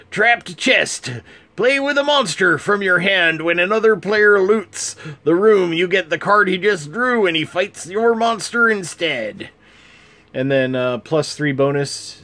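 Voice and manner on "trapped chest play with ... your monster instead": put-on pitched-up pirate accent for the card text. Reading the pirate set's cards to viewers in a pitched-up pirate accent. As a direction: growl it out like a cheery pirate, higher. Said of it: "I'm gonna do a pirate accent for all of this".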